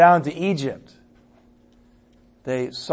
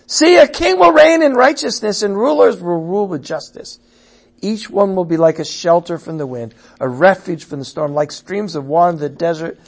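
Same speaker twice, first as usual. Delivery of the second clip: starting high and loud, then steady proclaiming; exultant scripture reading; 4 semitones higher, 9 dB louder than usual. Acted. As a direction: announce the coming king. Reads new prophecy with force.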